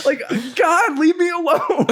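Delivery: high, whiny plea; mock exasperated wail. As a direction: wail it, begging the cat for peace. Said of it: "I'm, like, fucking in the garage".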